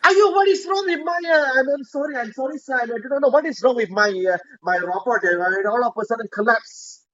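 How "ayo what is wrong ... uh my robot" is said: high, frantic apology; panicking owner pleading with a boss; flustered panic. Acted. Owner panics over broken robot.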